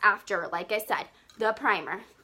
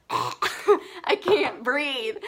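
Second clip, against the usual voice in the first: laughing; much slower than usual. Real, not acted. Laughing at her own breathing.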